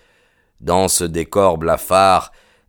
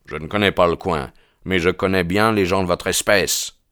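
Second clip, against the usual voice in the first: plain reading voice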